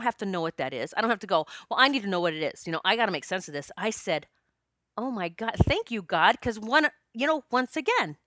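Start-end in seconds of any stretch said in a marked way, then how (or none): none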